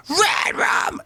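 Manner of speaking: very high screeching scream; different scream timbre. Shown as a demonstration. Singer showing scream timbres, the high screech. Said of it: "go into all different timbres".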